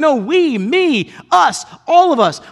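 loud, high, shouting emphasis; full-force preaching. Acted. Preacher stressing we are all sinners, shouting.

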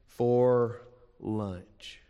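normal talking voice

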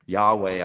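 "Yahweh" said out loud and clear as YAH-way; careful pronunciation. Shown as a demonstration. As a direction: proclaim "Yahweh" as YAH-way, stressing the first syllable. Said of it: "is the most common English pronunciation".

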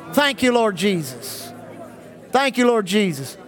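ordinary delivery

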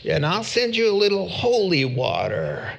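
mock a southern TV preacher begging for a jet; southern televangelist. Preacher lampooning a late-night TV evangelist in a southern accent. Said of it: "I don't know why it went southern".

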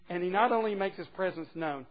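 ordinary delivery

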